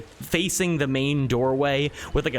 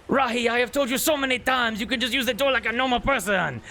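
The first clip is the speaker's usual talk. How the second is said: higher, fussy nobleman's voice; a high-pitched nobleman scolding an old friend; exasperated duke; 10 semitones higher, faster than usual. Acted. Duke scolds the friend tapping on the glass, higher and exasperated.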